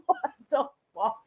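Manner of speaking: usual speaking voice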